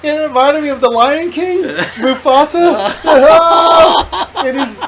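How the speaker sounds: high-pitched, laughing through the words; can barely get it out for laughing; laughing hard. Real, not acted. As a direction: friend laughing while telling the pretzel story. Laughing at pretzel spill climax.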